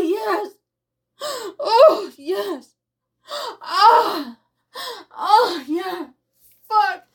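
high, loud cries of pleasure; climax moans. Acted. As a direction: let it peak, crying out high. Climax, crying out.

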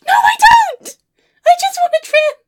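high, loud wail of protest; panicked shout. Acted. Client's inner voice protesting the complete change, panicked shout. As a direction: shriek it in horror, far above normal pitch.

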